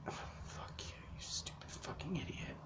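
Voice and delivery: hissed, breathless whisper aside; a sudden real whispered swipe; whispered insult. Real, not acted. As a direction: whisper the curse under your breath so nobody hears.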